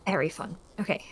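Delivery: normal talking voice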